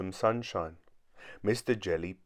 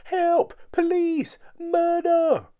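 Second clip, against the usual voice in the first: very high, loud cries for help; terrified scream; 7 dB louder, much slower than usual. A narrator's reading. Mister Jelly hit by a falling leaf, screaming for help. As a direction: scream each word in squeaky panic. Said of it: "he screamed".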